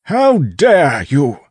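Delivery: slow, high, very loud bellow; outraged bellow. A narrator's reading. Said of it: "a familiar voice bellowed".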